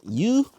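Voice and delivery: "You?" said high and loud, as a puzzled question; sounding out the brand. Shown as a demonstration. Asking viewers how to say the brand, "You?" high and puzzled. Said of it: "pronouncing it how my brain sees it".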